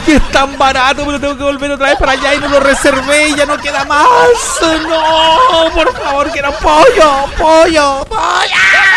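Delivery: high, hammed-up whimper, ending in a yelped pollo; mock crying whine. Radio host to listeners, pretending to cry over his last chicken, high mock whine. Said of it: "y empezáis como a llorar".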